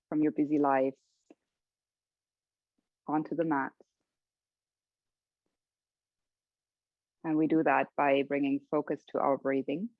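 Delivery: calm, even voice with long pauses; guided meditation. Real, not acted. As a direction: settle them gently into their breathing, unhurried. Leads a breathing meditation.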